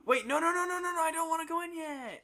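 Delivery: high frantic refusal; panicked protest. Real, not acted. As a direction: beg not to go in. Refusing to enter training.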